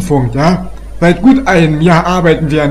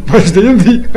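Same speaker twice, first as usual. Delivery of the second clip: ordinary talk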